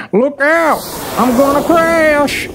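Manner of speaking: high-pitched, hammed-up panic; alarmed cry. Farmer's plane drops toward pigs, high alarmed cry.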